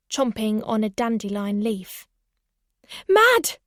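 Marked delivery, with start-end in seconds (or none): 3.1-3.6 high, loud call; crying out. A narrator's reading. Owner spotting the rabbit on the lawn, loud excited cry. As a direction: call sharply for a pet's attention. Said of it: "I cried out".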